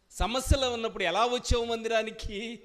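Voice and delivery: ordinary talk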